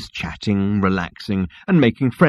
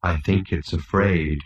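slow, low gloomy robot; low-pitched doleful drone; 5 semitones lower, 5 dB quieter, slower than usual. A narrator's reading. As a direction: deliver it low and slowly, resigned. Marvin the gloomy robot answers.

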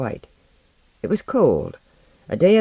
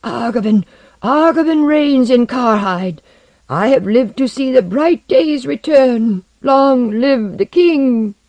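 loud, high, slow acclamation; fierce, shaking voice; 10 semitones higher, 7 dB louder, slower than usual. A narrator's reading. Old Kerr hailing the returned king, fierce and shaking. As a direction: cry it out fiercely, stretching the words. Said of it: "said in her fierce, shaking voice".

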